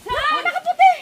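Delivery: high, loud alarmed pointing; frightened shouting. Real, not acted. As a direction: shout that you see it. Victim points at ghost.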